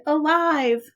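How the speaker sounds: ordinary delivery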